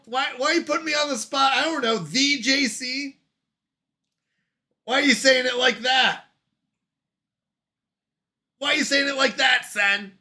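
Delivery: high-pitched, flustered questioning; flustered protest. Real, not acted. Streamer cornered by chat's question, flustered. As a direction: protest at being put on the spot. Put on the spot by chat.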